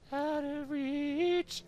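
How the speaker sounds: very high, slow, stretched mock; high mocking echo of the tutorial text; mocking falsetto. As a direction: sneer the phrase up high in falsetto.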